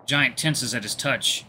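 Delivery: usual speaking voice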